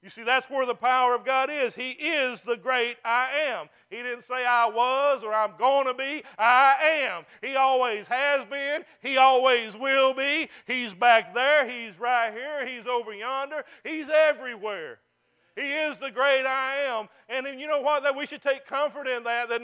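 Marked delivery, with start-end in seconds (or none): 4.4-6.2 I am punched louder; stress on I am. Acted. Contrast of tenses, punched. 6.4-15.0 louder, slow emphatic preaching. Acted.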